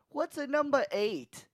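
high, put-on Chicago voice; mock Chicago cashier. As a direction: squeak the question in Chicago accent. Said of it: "Chicago accent".

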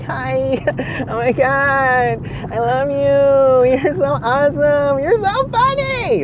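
put-on high, slow gushing drunk voice; gushing happy drunk. A happy drunk gushing at friends, high and slow. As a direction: gush love at everyone like a sweet drunk. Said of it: "I'm like".

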